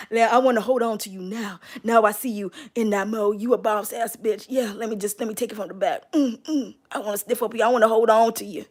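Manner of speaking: low, soft, seductive murmur; sultry admirer. Acted. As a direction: purr it like a lover leaning in. Voices the admirer's flirty thoughts.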